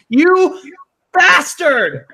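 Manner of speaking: high, loud cry of playful outrage; mock-outraged shout. Real, not acted. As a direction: yell it at the prankster who got you. Mock outrage at being trolled.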